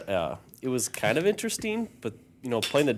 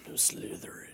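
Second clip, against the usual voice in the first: hushed whisper; 8 dB quieter than usual. Pretend. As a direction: whisper it urgently. Sorting Hat joke.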